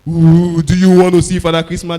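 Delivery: spooky mock Santa, loud; scary Father Christmas. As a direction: frighten the child as Santa. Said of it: "threatening... do something like this".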